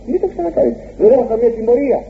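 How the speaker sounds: forgive him kindly; gentle absolution. Acted. Priest reassuring a penitent, high and gentle. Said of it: "Αυτό λέει".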